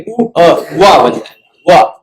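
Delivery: उ अ drawn out very slowly, then वा; slow sandhi demo. Shown as a demonstration. Teacher showing the class how उ and अ merge, very slowly. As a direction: stretch the vowels so the merge is heard. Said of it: "ये उ और अ जोड़ेंगे".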